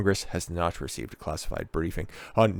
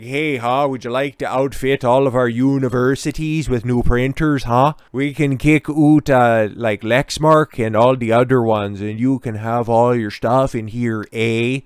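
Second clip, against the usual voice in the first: bad Canadian accent, raised and louder; mock Canadian accent selling printers; hack Canadian accent; 2 semitones higher, 7 dB louder than usual. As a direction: ham up a lousy Canadian accent. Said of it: "Can't even do a good hack Canadian accent".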